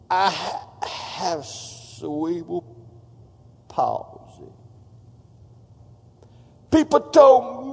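slow, strained, halting impression of his speech; imitating David Ring's speech. Pastor imitating the evangelist's labored speech to his congregation. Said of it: "He talk like this".

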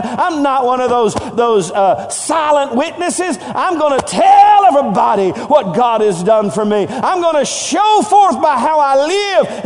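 rally the congregation, rising to a shout; fiery preaching crescendo into shouts; preaching at full force. Acted.

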